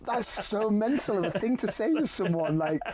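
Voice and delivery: high-pitched incredulous protest; retold disbelief. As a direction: protest as if baffled by the warning. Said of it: "I was like".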